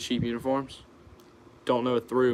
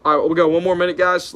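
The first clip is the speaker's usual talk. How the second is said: hyped announcement; 6 semitones higher, 8 dB louder than usual. Real, not acted. Livestream host calls out wrap-up.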